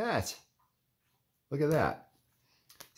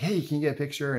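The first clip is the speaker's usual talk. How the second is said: higher-pitched retold plea; imitated eager request; 8 semitones higher, faster than usual. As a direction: act out hailing someone for a photo. Retold asking celebrity for photo.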